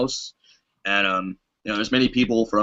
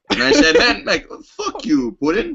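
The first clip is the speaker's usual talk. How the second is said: laughing; 12 semitones higher than usual. Retold or imitated. Retelling cursing Putin in dream.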